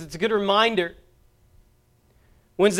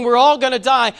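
a loud, retold cry of panic; mock the terrified voicemail, louder; retold panicked cry; 4 semitones higher, 8 dB louder than usual. Preacher retelling a doomed passenger's loud panicked cry. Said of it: "crying out to God and saying".

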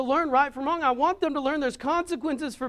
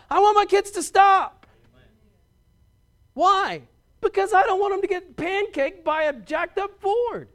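put-on louder, rising preacher's cry about his kids; fiery preaching; 4 semitones higher, 8 dB louder than usual. Preacher to the congregation on obeying parents, full-force preaching. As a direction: preach it hard and loud, building to the point.

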